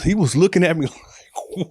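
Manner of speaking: ordinary delivery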